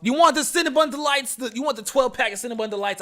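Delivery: high, loud Boston bro voice; Boston accent. Pretend. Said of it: "Why am I talking in a Boston accent?".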